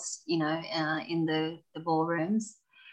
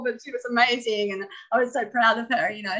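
usual speaking voice

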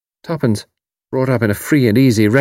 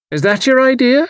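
Irish landlady; 4 dB louder than usual. A narrator's reading. Mrs O'Rourke voiced.

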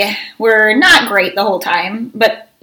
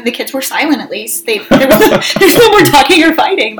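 usual speaking voice

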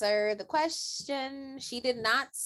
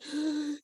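sudden audible shocked intake; retold gasp; 5 semitones higher than usual. Viewer finding out he is right there, shocked gasp. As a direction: gasp as if caught off guard.

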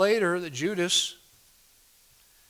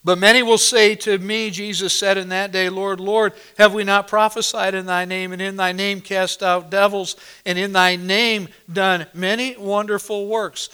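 loud, ringing pulpit delivery; thundering sermon voice; full-force preaching; 4 semitones higher, 7 dB louder than usual. Acted. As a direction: proclaim it to the whole congregation.

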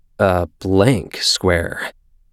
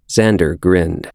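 plain reading voice

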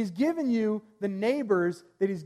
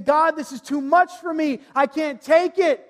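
high, loud, strained pleading; desperate cry to God; 7 semitones higher, 9 dB louder than usual. Acted. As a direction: cry out that it is too much. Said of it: "humbly crying out and saying".

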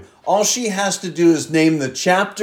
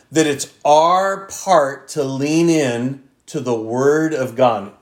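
ordinary talk, nothing marked

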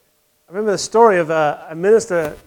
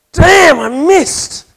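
higher, louder, slow drawn-out cry of annoyance; slow, loud, pitched-up frustrated curse; 8 semitones higher, 6 dB louder, much slower than usual. Retold or imitated. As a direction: groan it out like a golfer who just missed. Frustrated golfer misses putt.

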